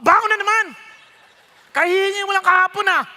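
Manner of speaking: irritated parent. Retold or imitated. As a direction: snap at the child. Galit na magulang sa anak. (naiinis na magulang)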